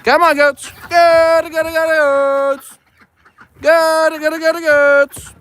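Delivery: high loud calling chant; high, loud farm call to goats. Shown as a demonstration. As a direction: call the goats from across the field. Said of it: "That is how you call goats".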